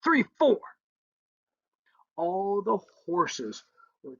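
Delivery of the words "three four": called out like a horse command; a bright called command; mock shout. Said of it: "you would shout".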